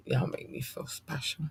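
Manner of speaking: very soft, near-whisper; touched. Real, not acted. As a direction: say it softly, moved by the fans. Touched by growing listener list.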